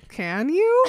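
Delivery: high, loud questioning swoop; mock incredulous question. Edward to Bella wanting to leave, mock incredulous. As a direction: laugh through a loud, high challenge.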